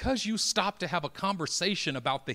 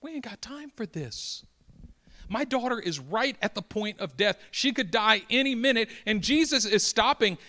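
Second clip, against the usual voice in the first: higher-pitched, urgent worried dad; anxious father; 7 semitones higher, 4 dB louder than usual. Acted. Jairus fretting that Jesus stops while his daughter dies, urgent. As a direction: fret like a father out of time. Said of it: "if you're Jairus, you're thinking".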